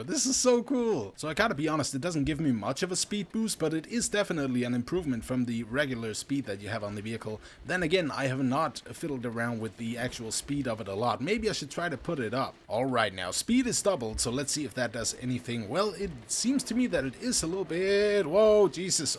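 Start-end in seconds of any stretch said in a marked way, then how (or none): 0.0-1.1 high, slow gushing; delighted. Real, not acted. Delighted by wing thrusters. 18.3-19.2 loud, high yelp; startled. Real, not acted. Vehicle lurches suddenly.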